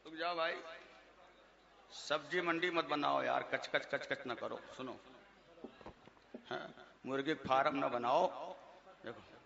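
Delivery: low, soft hushing of the crowd; softly shushing noisy listeners; quiet aside. Real, not acted. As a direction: murmur to the audience to settle down.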